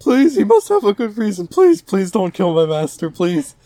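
high, loud begging; hammed-up desperate plea; mock pleading. Voicing Domon begging for his master, high mock plea.

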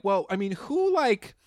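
squeaky, high incredulous asking; high mock question. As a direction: pitch way up, mocking their confusion. Mimicking people asking who's girl.

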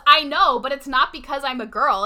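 2 loud, mock-indignant comeback; 3 fire it back at him, loud; 4 [name] snapping back at the teammate, loud retort.

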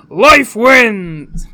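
failed tree creature shout. Acted. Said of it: "higher than that".

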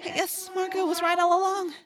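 put-on high, distant girl, sadly resigned. A narrator's reading. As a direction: admit defeat in a high, sad voice. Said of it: "Rachel said sadly".

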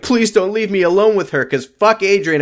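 high-pitched imitation of desperate begging; mock pleading. Host voices Grace begging Grant to stay, mock pleading. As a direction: plead in her voice, hammed-up.

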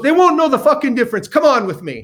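fast, high, insistent push; pushy urging. Retold or imitated. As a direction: hustle him along eagerly. Woman urging him into bar.

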